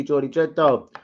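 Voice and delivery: ordinary talk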